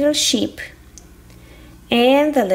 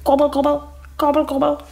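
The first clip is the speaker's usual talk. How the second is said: quick imitated turkey gobbling; turkey gobble; much faster than usual. Turkey arriving to join the train, gobbling. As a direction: gobble like a turkey in two bursts. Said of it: "Here's a turkey".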